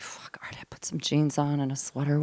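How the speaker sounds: voiceless, grudging whisper; resigned whisper. Retold or imitated. Retelling caving to her daughter, whispered and grudging. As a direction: give in under your breath. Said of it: "I'm like".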